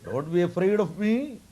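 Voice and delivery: a hint of a grand, booming child voice; baby on the banyan leaf calls Markandeya, mildly grand; big voice. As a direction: invite him in, unafraid, lightly played. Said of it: "with big voice".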